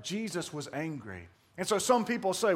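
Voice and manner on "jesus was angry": ordinary talk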